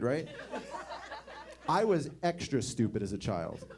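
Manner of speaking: ordinary talk, nothing marked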